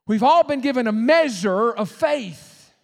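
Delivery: ringing pulpit declaration; emphatic preaching. Real, not acted. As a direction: declare it big, word by word. Preacher proclaiming to congregation.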